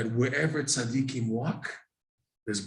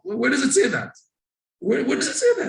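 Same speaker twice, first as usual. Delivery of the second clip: high, loud rhetorical demand; exasperated challenge; 12 semitones higher, 5 dB louder than usual. Acted. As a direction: challenge it with a sharply raised pitch. Challenging an imagined objection.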